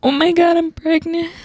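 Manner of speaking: a high, thrilled gasp of news; mock-reliving the shock, bright and high; excited. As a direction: shoot the pitch way up in disbelief. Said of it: "I was like".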